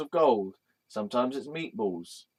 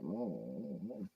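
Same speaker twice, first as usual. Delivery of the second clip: low, soft saucy hum; 6 semitones lower, 16 dB quieter, slower than usual. Acted. Imitating Ganesh looking saucy, low suggestive hum. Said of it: "Sometimes he looks kind of saucy. He's like".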